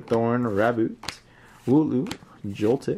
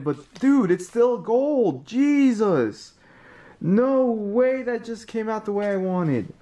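thrilled; 7 semitones higher than usual. Real, not acted. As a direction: celebrate the pull. Celebrating the gold card pull.

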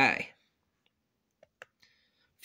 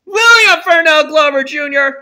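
loud, high full-name yell; furious shout; 7 semitones higher, 12 dB louder than usual. Acted. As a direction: roar after the departing car. Dad yells full name.

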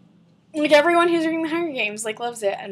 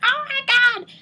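high retold squeal of delight; amazed exclamation; 11 semitones higher than usual. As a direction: re-enact a thrilled, high-pitched gasp of surprise. Acts out own amazed reaction.

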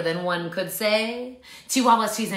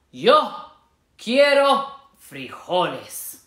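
slow, a touch of solemn; somber declaration; 6 dB louder, much slower than usual. Shown as a demonstration. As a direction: declare it gravely. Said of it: "Say it with me".

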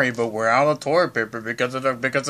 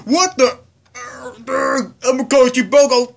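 higher, loud flustered alarm; alarmed panic; 9 semitones higher, 7 dB louder than usual. Pretend. Shopkeeper realising the fake card, loud panic. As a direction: panic and reach for help. Child alarmed, calling police.

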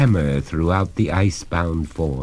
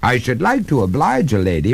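lightly voiced polite master, quicker; mildly gallant, pitch a little up; courteous; 6 semitones higher, faster than usual. A narrator's reading. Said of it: "returned the master courteously".

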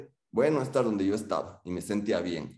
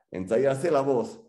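mock rehearsal; 4 semitones higher, much slower than usual. Mock clearing voice before lecture.

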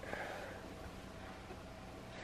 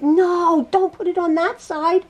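mild mock yell, higher in pitch; 4 semitones higher than usual. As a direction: imitate viewers calling out a warning. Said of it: "like, yelling at me".

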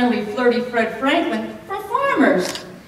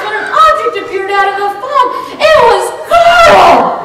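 mock horror; 8 semitones higher, 7 dB louder than usual. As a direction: shriek out the discovery, hammed up. Object appears in the fog.

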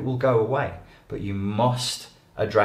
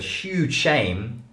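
usual speaking voice

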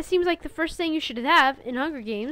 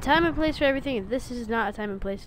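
ordinary talk, nothing marked

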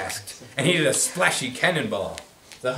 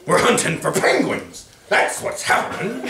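mild growl; 7 semitones higher, 3 dB louder than usual. Acted. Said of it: "They growled".

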